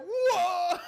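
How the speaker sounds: huge high mock "whoa"; shocked crowd. Imitating people hearing their raunchy jokes, loud shocked yell. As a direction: bellow it high like an appalled onlooker. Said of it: "we'd have people be like".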